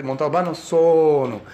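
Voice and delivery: "Montalbano sono" in a Sicilian lilt; Sicilian phrasing. Retold or imitated. Sicilian commissar giving his name, Sicilian lilt. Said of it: "that's the way Sicilians talk".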